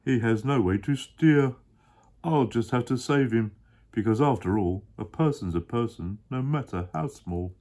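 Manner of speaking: a big kind elephant voice, sure of himself; deep Horton voice, earnest. Acted. Dad as Horton explaining to his child.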